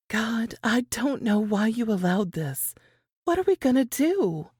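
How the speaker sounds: put-on a scared young woman begging God for help; frightened prayer. Lily praying alone in a rough tavern room, frightened.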